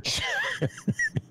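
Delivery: real high laugh mid-reading; burst of laughter. Real, not acted. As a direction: crack up halfway through the sentence. Cracks up while reading.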